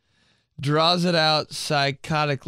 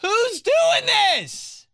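very high, drawn-out scream; outraged high-pitched yell; exasperated scream; 4 dB louder, much slower than usual. Real, not acted. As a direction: shriek it slowly in disbelief. Screaming over a mispronunciation.

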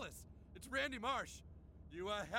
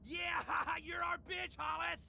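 stretched-out jubilant jeer; gloating taunt. Acted. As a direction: crow it at your rival, drawing it out. Father crows at rival.

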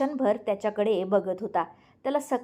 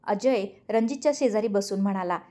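plain reading voice

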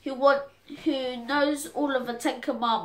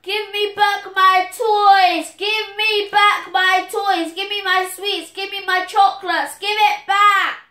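pretend shouting; 8 semitones higher, 7 dB louder than usual. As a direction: bellow it after a fleeing friend. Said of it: "I'll run after them shouting".

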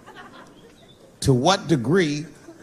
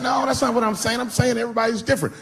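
defensive; 9 semitones higher, faster than usual. Retold or imitated. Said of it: "I said".

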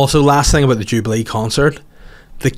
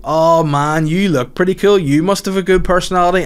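gushing admirer; 6 semitones higher than usual. Retold or imitated. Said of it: "so people go".

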